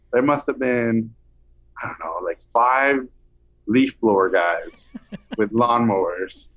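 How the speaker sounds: normal talking voice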